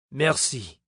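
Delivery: put-on French accent. A narrator's reading. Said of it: "In his French accent".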